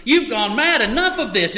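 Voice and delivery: loud retold rebuke. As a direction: bark it at Paul, mildly hammed. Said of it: "he says it loudly".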